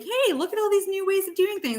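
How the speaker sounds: high bright fairy call. Acted. Reader voicing the nymph offering new ways, high. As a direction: sing it out like a nature nymph. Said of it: "that's like".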